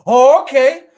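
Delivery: high, loud, cocky Denzel Training Day rant. Retold or imitated. Telling Tino he is in charge now, Denzel-style rant. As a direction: strut through it like a crooked cop. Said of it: "I turned into Denzel on, uh, Training Day".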